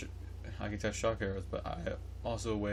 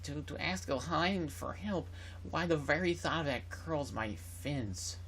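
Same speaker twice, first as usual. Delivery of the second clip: much higher, slow, odd fussy courtier voice; weird voice; 6 semitones higher, slower than usual. Acted. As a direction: play a haughty advisor, high and drawn out. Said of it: "I gave him a weird voice".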